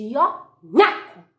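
loud playful chomp sound; loud gobble. A narrator's reading. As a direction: snap it out like a bite. Wolf chomps.

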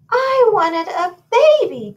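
high, loud wail of a disappointed queen; crying out. A narrator's reading. Queen finds a goat instead of a baby, acted wailing cry. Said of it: "cried the queen".

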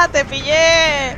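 loud laugh into drawn-out cry; cackle, then crow it slowly; gloating laughter. Real, not acted. Killer catching a hiding player, loud laughing gloat.

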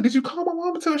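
high-pitched retold asking; retold surprised question. Asking friend's mom about the food, high and surprised. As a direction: ask it high, taken by surprise.

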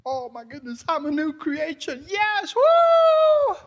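hammed-up celebration, building fast; so excited. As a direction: climb from high gushing to a loud whooping shriek. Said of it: "We're so excited".

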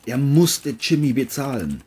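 ordinary delivery

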